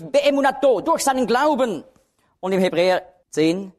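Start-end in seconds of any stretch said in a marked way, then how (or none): 0.0-0.8 Beemunato said high and set apart; stressed Hebrew word. Shown as a demonstration. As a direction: lift Beemunato as the accent. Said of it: "Im Galaterbrief". (Beemunato hoch betont)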